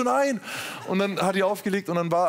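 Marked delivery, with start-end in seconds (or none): 0.0-0.4 mock dismay. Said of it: "Und ich so".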